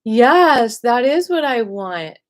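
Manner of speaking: loud, bold declaration; courageous yes. Pretend. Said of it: "it takes a lot of courage to say".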